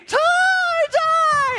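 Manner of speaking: very high, drawn-out mock squeal; screaming fangirl imitation. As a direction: shriek like a hysterical young fan. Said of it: "started screaming and yelling and waving".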